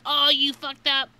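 high, loud mock taunting; gloating kids. Kids to teacher who swore, high mock gloating. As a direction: imitate children delighted someone's in trouble.

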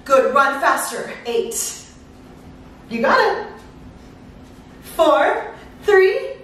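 ordinary delivery

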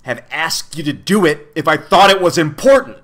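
building to a loud, high burst; angry snarl. A narrator's reading. The man rebukes Milo for objecting, anger building. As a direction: let the anger climb to the last word. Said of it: "he snarled angrily".

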